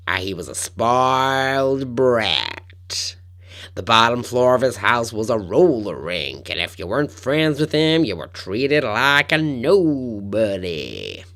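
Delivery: slow, loud, thick Carolina drawl; thick Carolina accent. Retold or imitated. Podcaster quoting Wisnett on spoiled John, thick Carolina accent. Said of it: "Carolina accent".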